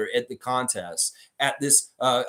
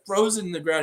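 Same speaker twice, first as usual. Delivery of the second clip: normal talking voice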